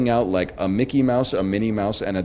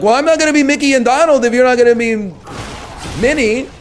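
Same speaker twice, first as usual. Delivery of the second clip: much higher, louder and fast; fed up, blurt it all out; exasperated outburst; 12 semitones higher, 7 dB louder, faster than usual. Retold or imitated. Frustrated dad arguing in play.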